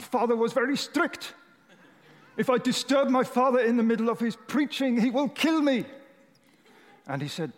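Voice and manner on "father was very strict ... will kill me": put-on high, agitated German accent. German preacher recalling his fear of his strict father, agitated German accent. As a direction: panicky German-accented boy, pitched well up. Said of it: "Doing my best German accent".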